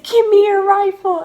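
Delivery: high, loud imitation of a command; retold sergeant order. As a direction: demand it like an angry sergeant in a story. Retold sergeant demanding the rifle.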